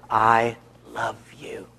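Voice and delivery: slow, hushed whisper of love; whispered tenderness. Acted. Husband holding his wife close, whispering tenderly. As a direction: whisper it slowly, drawing it out.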